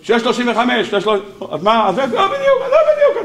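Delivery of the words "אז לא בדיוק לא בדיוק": high squeaky laugh through the words; laughing mock protest. Real, not acted. Rabbi to his class, mocking the precision, laughing high. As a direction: squeal it out, amused by the idea.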